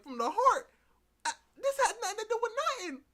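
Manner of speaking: loud, high, rapid-fire objection; mock outrage. Viewer disputing Kat's from the heart claim, loud high mock outrage. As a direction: fire back in hammed-up disbelief.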